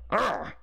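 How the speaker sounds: high, disgusted growl of annoyance; exasperated "urgh". Acted. Man hating Bluetooth talkers, exasperated groan. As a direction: let out an irritated urgh.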